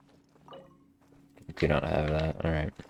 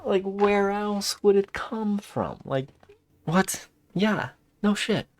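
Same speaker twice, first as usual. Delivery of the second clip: mock disbelief; 14 semitones higher, slower than usual. Real, not acted. Mocking an obvious question.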